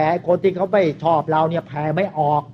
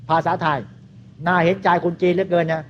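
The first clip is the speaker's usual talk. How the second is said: ordinary delivery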